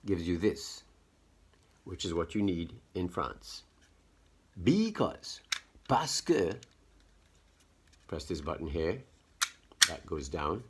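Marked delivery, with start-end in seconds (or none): 4.6-6.5 put-on high-pitched French-accented presenter. Explaining a gadget to viewers, in a put-on French accent. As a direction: slip theatrically into a French accent. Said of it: "You hear, like, my French accent".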